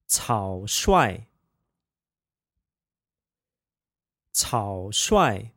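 草率 said cǎo shuài, low then sharp fall; 草率 with third tone then fourth tone; practicing tones. Shown as a demonstration. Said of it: "third tone, fourth tone pair".

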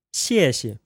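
谢谢 with a falling first syllable and a light, toneless second; Mandarin tones. Shown as a demonstration. Teacher models 谢谢 as thank you for learners. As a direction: drop the first 谢 hard, barely touch the second. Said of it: "X I E with a fourth tone, followed by X I E with a neutral tone".

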